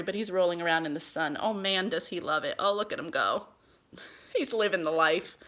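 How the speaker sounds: ordinary talk, nothing marked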